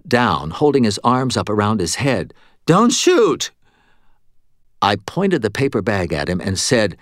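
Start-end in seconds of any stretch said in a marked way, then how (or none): 2.7-3.5 terrified plea. A narrator's reading. Cashier flinching, terrified.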